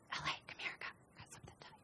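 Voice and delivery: whisper. Acted. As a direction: coach whispering in the ear.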